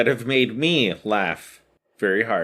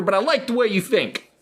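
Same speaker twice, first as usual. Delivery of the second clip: put-on higher kid voice echoing the teacher; little Johnny mocking; 8 semitones higher than usual. Johnny throwing the teacher's line back, higher. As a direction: parrot the teacher like a smug kid. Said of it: "And he goes".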